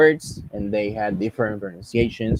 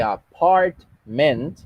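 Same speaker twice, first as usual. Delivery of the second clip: a-PART-ment, broken up with PART punched; stress on middle syllable; 3 semitones higher, 3 dB louder, much slower than usual. Shown as a demonstration. Teacher giving an example, apartment stressed in the middle. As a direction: separate apartment slowly and hit the middle. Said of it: "está en medio".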